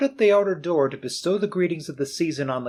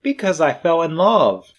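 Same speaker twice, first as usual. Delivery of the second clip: louder, earnest declaration; sincere answer; 4 semitones higher, 8 dB louder than usual. Acted. Nephew explaining his marriage to Scrooge, sincere. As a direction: say it openly, with feeling.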